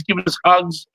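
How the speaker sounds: normal talking voice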